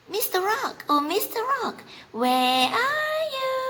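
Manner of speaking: the bunny calls back over his shoulder, far up and teasing; calling bunny voice. Acted.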